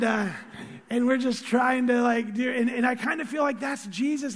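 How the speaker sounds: ordinary delivery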